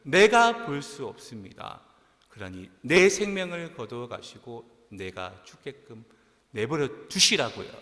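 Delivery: loud, emphatic complaint as Jonah; defiant protest. Acted. Preacher voicing Jonah's answer to God, loud defiant protest. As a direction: push back hard, punching the key words.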